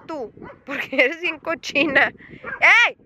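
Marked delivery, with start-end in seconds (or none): none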